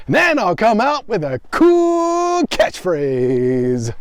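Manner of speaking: booming, slow, high sports announcer; NHL announcer voice. Pretend. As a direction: hype it like an arena announcer. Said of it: "like some N H L announcer".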